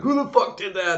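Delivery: high-pitched indignant demand; mock outrage. Driver to laughing crowd after cone hit, mock outrage.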